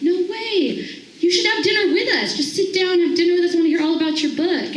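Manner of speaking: excitedly begging the stranger to sit down; eager invitation. Retold or imitated. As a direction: gush at him to join you.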